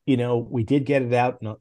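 ordinary delivery

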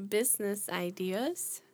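"business ideas" said slowly and deliberately; slow careful "business ideas"; careful correction. Real, not acted.

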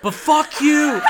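sudden high, very loud shout; mock furious yell. Girlfriend turning on boyfriend, mock furious yell. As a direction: flip into a screamed mock insult.

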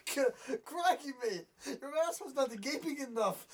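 a crude mock Australian accent he admits he can't do; bad Australian accent. Host riffing on an Australian bar mitzvah, in a bad mock Australian accent. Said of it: "I can't do Australian".

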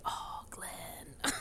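a soft, breathy whisper; whispered sigh. Real, not acted. Whispering fondly about the sweet guy on the show. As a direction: whisper his name fondly. Fond sigh over a character.

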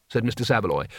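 plain reading voice

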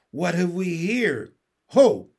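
imperious royal demand. A narrator's reading. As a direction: challenge an intruder, ending on a loud "Ho!". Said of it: "he demanded".

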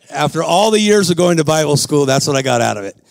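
usual speaking voice

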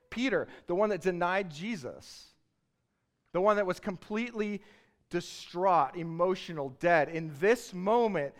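a sermon swelling into a loud, high climax; building preaching. Acted. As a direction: preach it up to a shout. Preacher building to full force.

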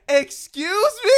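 high, loud, drawn-out disbelief; incredulous. Retold or imitated. Friend challenging what James just said, incredulous. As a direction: replay being utterly taken aback. Retelling own shocked reaction.

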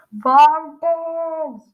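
loud, high, drawn-out bellow; angry coach's shout. A narrator's reading. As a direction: bellow the culprit's name in outrage. Coach slams down megaphone, yells at pupil.

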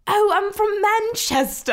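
high, slow, loud mock Manchester accent; exaggerated imitation of a Manchester driver. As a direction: stretch out a hammed-up Mancunian. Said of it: "And he's like".

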